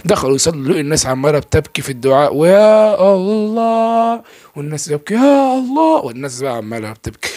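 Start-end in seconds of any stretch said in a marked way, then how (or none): 2.3-4.2 long, very drawn-out, higher and louder call of يا الله; imam's drawn-out prayer call. Retold or imitated. Retelling the imam calling on God at dawn, drawn-out chant. 5.1-6.0 much higher, louder cry of يا الله; imam's prayer call. Retold or imitated. Retelling the imam's call during the crying prayer, high cry.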